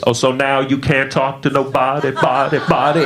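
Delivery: LaWanda calling across school, fading echo on body, body. Retold or imitated. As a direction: let the last word ring out like an echo. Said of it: "And it echoed like that".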